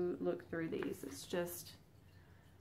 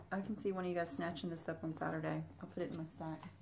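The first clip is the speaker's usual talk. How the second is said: ordinary talk, nothing marked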